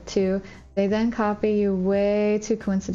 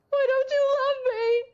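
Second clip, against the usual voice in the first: mock wail. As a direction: wail it out dramatically. Escalating mock hurt.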